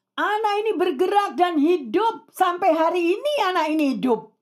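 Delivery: high, ringing preacher's declaration; triumphant proclamation. Real, not acted. Preacher declares to the congregation the child lives, triumphant proclamation. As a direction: proclaim the miracle with rising fervour.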